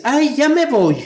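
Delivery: nervous aunt hurrying off, high mock voice; imitating aunt. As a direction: squeak it out like an awkward aunt escaping. Aunt leaving uncomfortably, retold.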